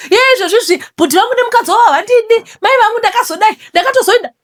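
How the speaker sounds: very high, loud mimicked wail; mock complainer. Storyteller mimicking someone complaining about a brother and his wife, high mock wail. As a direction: rush it out in an exaggerated high put-on voice.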